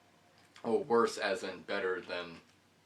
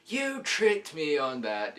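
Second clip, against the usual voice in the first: slower, loud and still high, hammering it home; slow outraged repeat; 8 semitones higher, 9 dB louder, slower than usual. Retold or imitated. Kid repeating the accusation to the operator, slow and loud. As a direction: drag it out like an accusation. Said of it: "I'm like".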